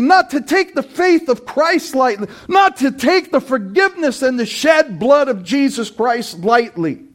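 high, loud, impassioned pulpit cry; fiery preaching. Acted. Preacher warning the congregation about false believers, fiery loud preaching. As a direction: preach it out full force to the congregation.

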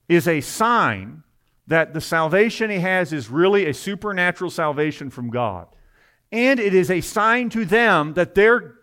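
high, loud, driving sermon delivery; forceful preaching. Real, not acted. Preacher urging the congregation to face fear, forceful.

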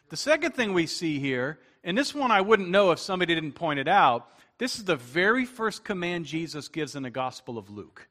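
normal talking voice

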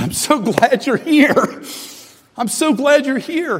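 retelling the words through tears; overwhelmed, grateful old friend; tearful relief. Retold or imitated. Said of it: "I started weeping".

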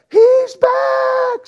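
high, loud alarmed scream. Retold or imitated. Camper screaming that the bear has returned, high and loud. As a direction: scream a warning across the campground. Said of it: "Somebody else is screaming".

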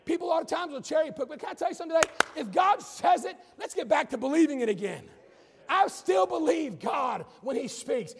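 preacher rallies the church, loud and fervent; full-force preaching. Real, not acted.

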